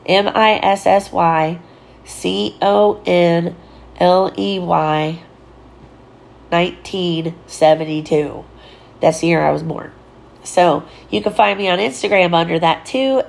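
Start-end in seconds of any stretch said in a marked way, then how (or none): 0.1-8.4 slow spelling. Shown as a demonstration. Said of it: "spelling the handle aloud".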